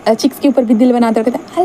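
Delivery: ordinary delivery, nothing marked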